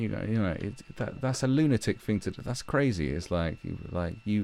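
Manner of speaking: ordinary talk, nothing marked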